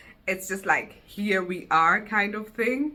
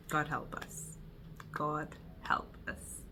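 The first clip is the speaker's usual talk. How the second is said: whispered low prayer; whispered plea; 5 semitones lower, 15 dB quieter, slower than usual. Real, not acted. Asking God for help, whispered. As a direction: breathe it out as a prayer.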